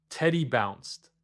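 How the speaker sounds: ordinary delivery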